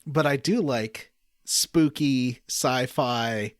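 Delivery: normal talking voice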